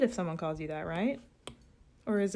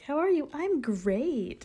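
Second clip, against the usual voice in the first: fake American cheeriness; 7 semitones higher, slower than usual. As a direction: chirp it like an automatic, untruthful pleasantry. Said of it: "Americans are always just like".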